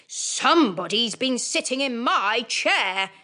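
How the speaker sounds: slow, rough big bear rumble; great gruff bear voice. Acted. Great bear finds his chair disturbed, slow gruff rumble. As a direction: growl it slowly like a huge bear. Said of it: "in his great rough, gruff voice".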